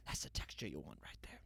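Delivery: put-on low breathy whisper, no accent; voiceless murmur of a TV cook admiring the crumbs; hushed chef imitation. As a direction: whisper it like a chef savoring the dough. Mimicking the chef's hushed line.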